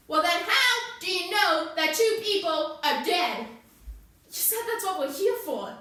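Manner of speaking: loud, high, forceful challenge; heated interrogation. Acted. Interrogator presses Sonny about two dead people, heated challenge. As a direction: hammer out each accusing word.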